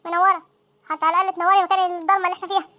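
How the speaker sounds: ordinary talk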